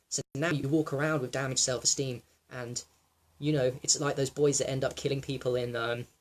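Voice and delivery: ordinary talk, nothing marked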